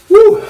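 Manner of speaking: high loud woo from the burn; loud heat woo. Real, not acted. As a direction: yell out the spice. Heat kicking in.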